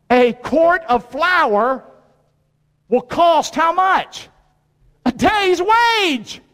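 very slow, rising high, each phrase spaced out; preacher hammering the price slowly; slow emphatic preaching. Acted. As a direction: drag it out for weight. Preacher drives home the point.

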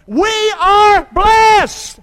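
high, loud, very slow proclamation; preacher leading the crowd in a shouted chant; shouted praise. Shown as a demonstration. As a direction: lift the whole room with each word. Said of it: "Say it with me".

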